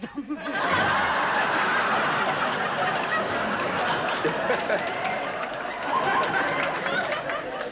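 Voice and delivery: high, loud laughing fit. Real, not acted. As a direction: cackle at the punchline. Laughs at punchline of joke.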